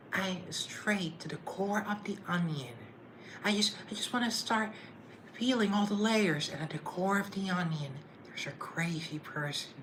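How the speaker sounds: channel Jennifer Coolidge musing dreamily; Jennifer Coolidge impression. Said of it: "that's my impression".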